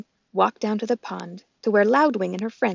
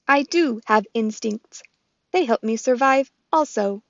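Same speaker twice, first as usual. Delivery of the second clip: robot voice; 2 semitones higher, 5 dB louder than usual. Acted. Roz the robot speaks.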